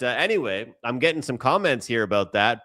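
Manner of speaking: ordinary talk, nothing marked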